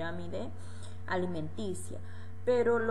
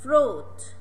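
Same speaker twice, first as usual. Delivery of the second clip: loud model word; 4 semitones higher, 9 dB louder than usual. Shown as a demonstration. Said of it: "Repeat, please".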